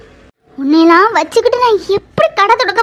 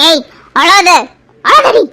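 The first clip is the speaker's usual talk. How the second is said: put-on louder, telling the kids to stop crying; sharp shout; 5 dB louder, slower than usual. Mother shouting at her crying children, loud and sharp. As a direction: snap at them to hush.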